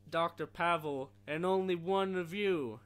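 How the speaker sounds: slow and loud agent voice; annoying CIA guy voice. Retold or imitated. Reciting CIA agent's demand, slow and loud. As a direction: lay it out slowly like a pushy agent. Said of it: "the C I A guy has the most annoying fucking voice ever".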